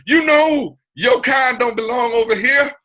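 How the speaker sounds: high, loud, laughing cop voice barking orders; mock police officer. Officer ordering speaker out of a rich neighborhood, mock shout through laughter. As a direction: play a cop chasing a man off the block, half laughing. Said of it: "Atlanta P D pull up on me and say".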